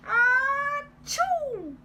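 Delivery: loud high exaggerated sneeze; big sneeze. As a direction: let out a huge sneeze. Wolf sneezes again.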